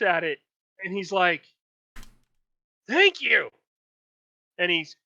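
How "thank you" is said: higher, louder thanks; old guard thanking the party for gold; grateful guard. Acted. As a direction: take the coin gratefully.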